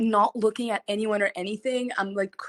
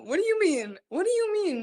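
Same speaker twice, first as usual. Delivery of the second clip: indignant disbelief; 10 semitones higher than usual. Real, not acted. Disbelief at staff.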